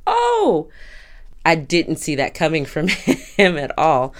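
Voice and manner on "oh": high startled oh; mock surprise, pitched way up; surprised oh. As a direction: react as if caught off guard. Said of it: "and I was like".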